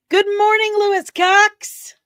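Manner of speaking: loud, high-pitched morning holler; shouted greeting. Acted. Streamer greeting viewers with a film line, shouted high. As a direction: belt it out high like a wake-up call.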